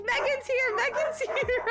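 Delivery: high loud squealy dog voice; playful high voicing of the dogs; excited dogs voiced. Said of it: "My dogs are like".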